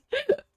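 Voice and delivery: squeaky burst of giggling; high flustered giggle. Real, not acted. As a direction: giggle up high, caught off guard.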